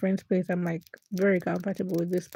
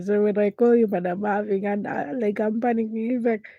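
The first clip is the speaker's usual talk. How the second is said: normal talking voice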